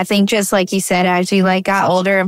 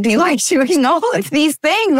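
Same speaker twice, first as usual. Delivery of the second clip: laughing and higher, a playful question; teasing laugh, higher; 7 semitones higher than usual. Retold or imitated. Mom teasing her young daughter about vacations, laughing and higher.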